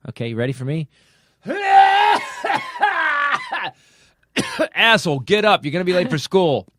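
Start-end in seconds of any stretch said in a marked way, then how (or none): 1.4-3.7 high, loud drawn-out scream; long wake-up yell. Acted. Demonstrating how he got woken up, loud high yell. 4.8-6.7 put-on loud parent rousting a kid; rude wake-up yell. Parent waking a kid late for school, loud scolding. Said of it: "that's how I got waked up".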